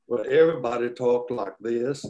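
slow, stretched South Carolina drawl; thick Southern US accent. Shown as a demonstration. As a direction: draw the vowels out like a Carolina child. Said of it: "to switch from a Southern drawl".

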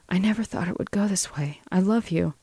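soft, high-pitched, heartfelt murmur; hushed, tearless declaration of love; tender confession. Acted. Lena fills Joel's eyes, confessing love softly.

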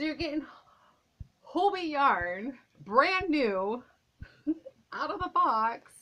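high, loud excited reveal. Real, not acted. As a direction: burst out with thrilled delight.

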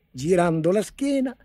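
plain reading voice

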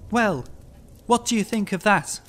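high-pitched urgent challenge; crying out. A narrator's reading. Haunted man challenging the clergyman, high-pitched cry. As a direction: cry it out, demanding an answer. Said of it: "at length Barton cried".

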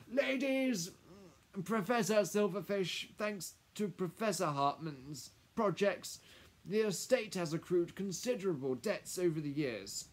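high, loud, halting formal announcement, dropping at the end; stalling lawyer. Acted. Lawyer breaking news of debts to household, stalling. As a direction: play for time before bad news.

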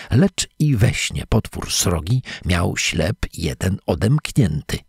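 plain reading voice

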